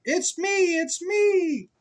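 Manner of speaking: very high, bright sheep voice. Acted. Sheep revealing itself to the child, very high. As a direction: squeak it out like a little sheep.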